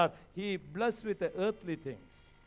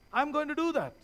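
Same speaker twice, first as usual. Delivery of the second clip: ordinary delivery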